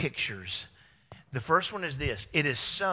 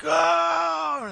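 exasperated oh; 13 semitones higher, 8 dB louder than usual. Retold or imitated. It happens again, road rage.

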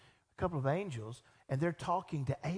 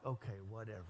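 much softer, lower mumbled dismissal; bored teen shrug; 8 semitones lower, 11 dB quieter, faster than usual. Retold or imitated.

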